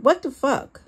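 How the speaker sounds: voiced yell of an angry partner. A narrator's reading. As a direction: yell it in a foul mood. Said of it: "Sean yelled".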